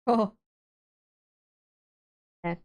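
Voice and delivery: ordinary talk, nothing marked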